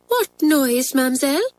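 high, innocent-sounding question; feigned innocence. A narrator's reading. Pupil covering for the hidden dog, innocent. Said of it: "with an innocent face".

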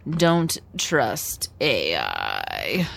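low, very slow robot voice. Pretend. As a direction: drone it like a deadpan machine. Joking about not trusting robots.

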